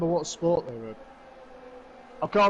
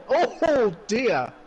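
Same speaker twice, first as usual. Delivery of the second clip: surprised; 7 semitones higher, 6 dB louder, much slower than usual. Real, not acted. Reacts to a crash on screen.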